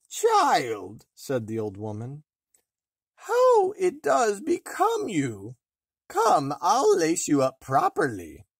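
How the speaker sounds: high, loud, slow wheedling hag; flattering old crone. A narrator's reading. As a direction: sweet-talk her into the laces. Disguised queen flatters her.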